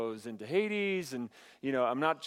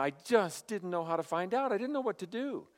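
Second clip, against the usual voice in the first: high, pleading mock cry; imitated weeping; 6 semitones higher than usual. Broken man answering Jesus for his wasted life, imitated weeping. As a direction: act out a broken man's wail, rising higher. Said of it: "he's just weeping, he's crying".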